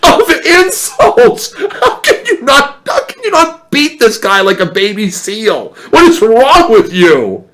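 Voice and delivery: high, loud, slow, laughing rant; cracking up, incredulous yell; laughing exasperation. Retold or imitated. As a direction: shout it through laughter. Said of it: "I'm like".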